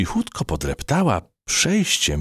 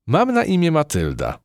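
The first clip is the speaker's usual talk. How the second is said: calling back; 10 semitones higher than usual. Acted. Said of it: "odkrzyknęła myszka".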